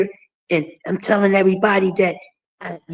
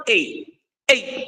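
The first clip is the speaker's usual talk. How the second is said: ordinary talk, nothing marked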